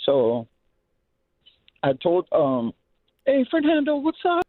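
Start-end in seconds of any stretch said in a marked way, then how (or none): 3.2-4.4 put-on high, bright call of a star-struck kid; excited kid greeting. Kid calling out to Fernando, high and excited.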